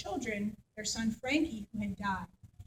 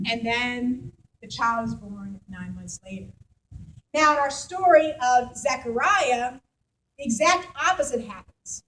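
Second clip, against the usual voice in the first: ordinary delivery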